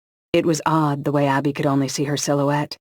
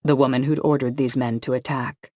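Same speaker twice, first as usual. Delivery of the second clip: ordinary delivery, nothing marked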